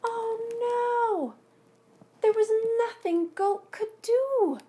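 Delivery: high, loud cry of dismay. Acted. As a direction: wail it out as the loss hits. Goat loses his dandelion.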